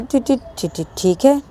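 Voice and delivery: stuttered, flustered giving in; acted tongue-tied assent; nervous agreement. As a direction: trip repeatedly on the first word. Nervously gives in.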